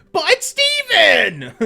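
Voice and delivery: high-pitched, loud, stretched-out cry; Greg. Acted. Streamer attempting Greg, high-pitched and loud. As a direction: wail it high and slow. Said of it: "in a high-pitched voice, it sounds like Greg".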